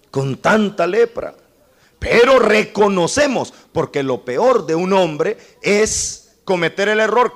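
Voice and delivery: loud, high and insistent; forceful preaching. Acted. Preacher warning sinners, loud and high. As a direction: hammer the warning home.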